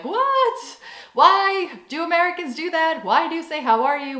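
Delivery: high-pitched, incredulous outburst; mock bewilderment. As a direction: act out the baffled rest of the world. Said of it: "everybody else in the world is like".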